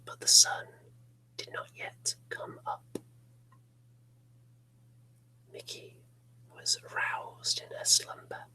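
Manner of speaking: hushed ghost-story whisper; whispered narration. Acted. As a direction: whisper it close and eerie.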